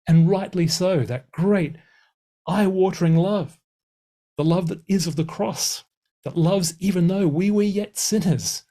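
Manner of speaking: fervent preacher's climax, ringing out; impassioned preaching. Acted. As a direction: lift it into full-force preaching about the cross. Preaching on the love of the cross.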